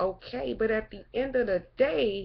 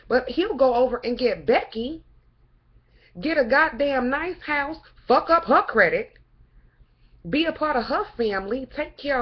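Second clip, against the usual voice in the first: louder and higher, bitter outrage; angry rant; 4 semitones higher, 6 dB louder than usual. Real, not acted. Angry woman ranting about men choosing Becky, loud and bitter. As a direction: spit it out at the man who left.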